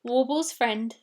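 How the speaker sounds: plain reading voice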